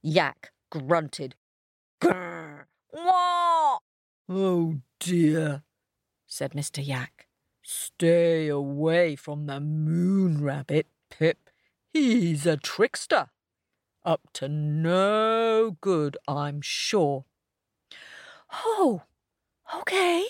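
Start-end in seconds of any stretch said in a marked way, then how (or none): 2.0-3.8 yak grunt. A narrator's reading. Yak's grunt. 4.3-17.2 a hint of a grumbly yak; gruff yak. A narrator's reading. Mister Yak voiced.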